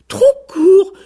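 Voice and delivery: high, loud indignant squawk; outraged cry. A narrator's reading. Offended rooster throws the goose's words back, outraged cry. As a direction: cry it out, affronted and shrill-high. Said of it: "s'écria le coq".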